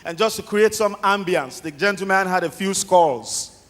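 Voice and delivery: ordinary talk, nothing marked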